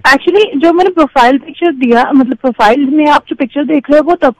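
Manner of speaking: loud, fast, insistent explaining; indignant self-defence. Real, not acted. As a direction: cut in and justify yourself at speed. Defends photo after remark on complexion.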